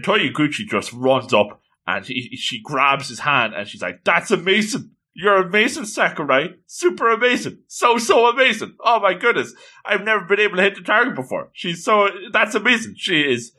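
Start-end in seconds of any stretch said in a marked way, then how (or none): 4.0-13.0 high-pitched, gushing excitement; overjoyed. Acted. Girl amazed at finally hitting the target, overjoyed. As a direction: act out incredible joy in a higher voice. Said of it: "She is just incredibly overjoyed".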